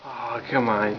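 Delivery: ordinary talk, nothing marked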